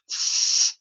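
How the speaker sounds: unvoiced th friction; voiceless th hiss. Shown as a demonstration. Teacher demonstrating the voiceless th. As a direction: push air through the teeth, no voice. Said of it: "T H".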